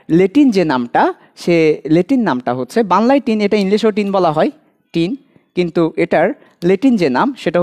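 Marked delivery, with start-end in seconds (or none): none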